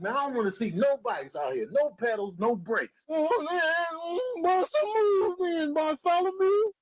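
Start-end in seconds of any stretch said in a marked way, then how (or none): 3.1-6.7 cocky ram bully. Acted. Ram character in a high voice.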